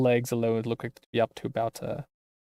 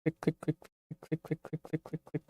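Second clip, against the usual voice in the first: fading clicks; 14 dB quieter than usual. Acted. Pressing the button over and over.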